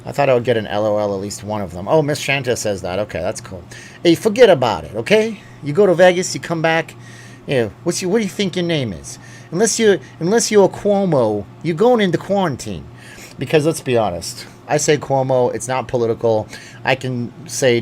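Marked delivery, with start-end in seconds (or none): 4.0-12.9 hammed-up New York wiseguy; New York tough guy. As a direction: warn him off like a wiseguy. Mock New York enforcer voice.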